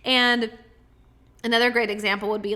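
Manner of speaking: usual speaking voice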